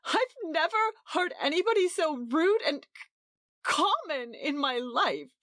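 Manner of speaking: high, tearful, breaking on the word; a crying wife on how rude her hostess is. A narrator's reading. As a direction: choke on the insult mid-word. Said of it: "Edith began to cry".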